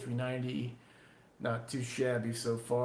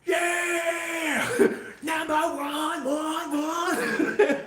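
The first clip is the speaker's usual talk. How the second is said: much higher, loud party yells; wild whooping; 13 semitones higher, 13 dB louder, much slower than usual. Real, not acted. Streamer cheering himself through a beer chug, whooping at full blast. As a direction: cheer yourself on while chugging.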